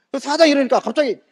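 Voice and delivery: usual speaking voice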